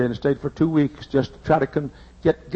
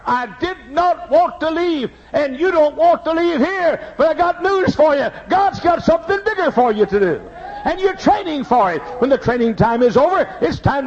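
preaching; 9 semitones higher than usual. Acted.

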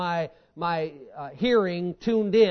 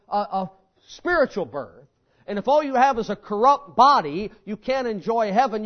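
usual speaking voice

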